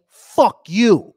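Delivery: loud, emphatic mock dismissal; mock government voice. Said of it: "They're basically saying".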